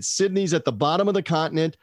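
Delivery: ordinary delivery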